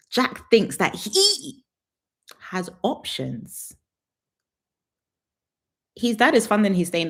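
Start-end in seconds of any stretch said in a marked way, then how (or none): 0.1-3.7 very slow, drawn out. Shown as a demonstration. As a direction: stretch each word, very slow. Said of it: "drawn-out repeat".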